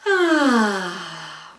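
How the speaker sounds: long open sighed ah, falling away; big sigh. Shown as a demonstration. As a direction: let the breath go in a big sigh. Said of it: "Let it out in a big sigh".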